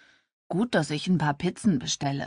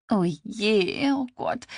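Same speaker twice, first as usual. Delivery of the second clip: higher, laughing exclamation; 4 semitones higher, slower than usual. A narrator's reading. Claire hears awful karaoke, laughing. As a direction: laugh at the bad singing. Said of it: "lachte Claire".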